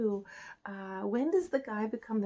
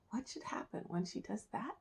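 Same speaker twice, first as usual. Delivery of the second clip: ordinary delivery, nothing marked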